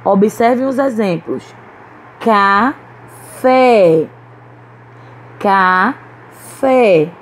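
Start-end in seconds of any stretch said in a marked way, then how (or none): 2.2-4.1 "Café" split in two, strong drawn-out fé; stress on last syllable. Shown as a demonstration. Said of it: "A sílaba forte é a última". 5.4-7.1 "Café" split in two, strong drawn-out fé; stress on last syllable. Shown as a demonstration. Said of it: "A sílaba forte é a última".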